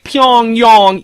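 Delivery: smug mock pronunciation of Pyongyang; mock expert Pyongyang. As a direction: parody the wannabe expert's careful Pyongyang. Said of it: "they're like".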